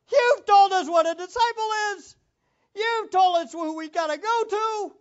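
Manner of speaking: loud, high cry of despairing disciples; hammed-up panicked disciple. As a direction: throw up your hands as an overwhelmed follower. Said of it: "disciples would say the same thing".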